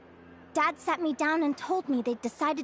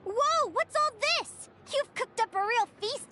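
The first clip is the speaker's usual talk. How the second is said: wide-eyed at the spread; amazed; 8 semitones higher than usual. Real, not acted. Amazed at the feast.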